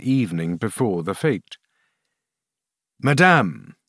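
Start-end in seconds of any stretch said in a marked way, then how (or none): 3.0-3.8 dignified, higher-set king. A narrator's reading. King addressing the queen, with dignity. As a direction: address her with stately dignity. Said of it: "said he with dignity".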